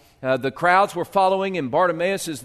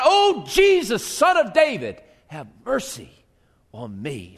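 crying out; 4 semitones higher, 3 dB louder than usual. Retold or imitated. Believers crying out to Jesus for mercy, pleading. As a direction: cry to Jesus, then fall to a hush. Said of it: "crying out".

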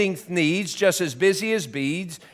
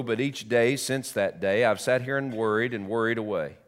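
soft, low mournful telling; sad narration; 5 semitones lower, 4 dB quieter than usual. A narrator's reading. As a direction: tell the ending with regret.

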